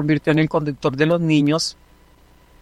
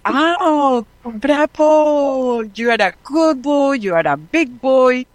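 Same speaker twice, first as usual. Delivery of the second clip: put-on praising a child; 7 semitones higher, much slower than usual. Praising the boy like a parent.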